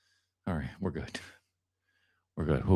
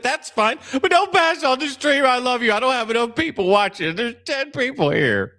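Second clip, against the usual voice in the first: high, loud indignant plea to viewers; hammed-up wounded rant; mock outrage; 10 semitones higher, 4 dB louder than usual. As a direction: plead with them in exaggerated, high-pitched indignation.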